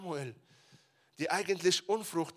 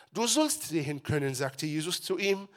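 louder, earnest Bible reading; scripture reading; 9 dB louder than usual. Acted. Pastor reading the healing passage aloud, earnest. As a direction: read it to the congregation with weight.

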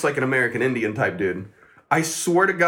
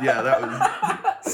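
laughing; much slower than usual. Real, not acted. Laughing at his own story.

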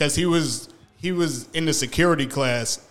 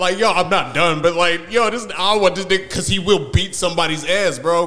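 excited; 7 semitones higher, 8 dB louder than usual. Real, not acted. Excited retelling, fearing roommate.

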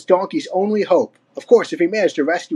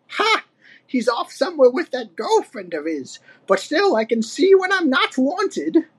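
put-on high-pitched huffy old ape; Cranky, snorting; 9 semitones higher than usual. A narrator's reading. Old ape dismissed by Donkey Kong, huffy. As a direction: huff in a high old-timer voice. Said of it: "Cranky snorted".

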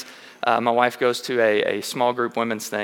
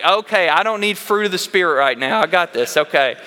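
exasperated; 6 semitones higher, 5 dB louder than usual. Retold or imitated. Dad brushing off lecturing son.

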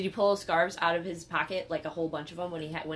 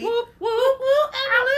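whooping cheer; 11 dB louder than usual. Real, not acted. Hyping a friend's shout out.

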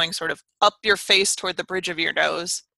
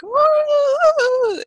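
put-on high, thin old lady "woo"; little old lady voice; 6 dB louder than usual. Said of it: "little old lady voices that are always like".

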